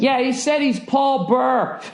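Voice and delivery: mock the confused attendant mishearing the name; parking lady. Parking attendant relaying to comedians, mimicked high. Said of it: "She's like".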